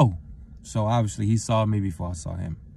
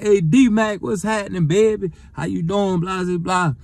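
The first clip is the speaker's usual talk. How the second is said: cheery greeting; 9 semitones higher, 5 dB louder than usual. Retold or imitated. Sean greets him from car.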